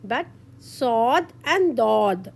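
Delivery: ordinary talk, nothing marked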